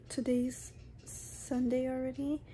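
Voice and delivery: slow whispered aside. Real, not acted. Vlogger noting the late hour, whispering. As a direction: murmur it tiredly without voice.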